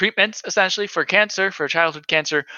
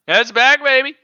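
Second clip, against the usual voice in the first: higher, louder, hyped; excited; 9 semitones higher, 6 dB louder than usual. Real, not acted. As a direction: pump it up, really celebrate. Excited news announcement.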